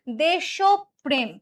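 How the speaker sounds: দেশপ্রেম slowly, syllable by syllable as desh-o-prem; correct pronunciation. Shown as a demonstration. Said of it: "উচ্চারণ হবে হচ্ছে".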